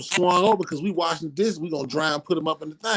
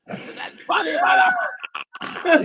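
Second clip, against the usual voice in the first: high shouted sneer, drawn out slowly; furious mama; slower than usual. Retold or imitated. Mother taunting her slapped child, slow high shout. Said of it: "She was like".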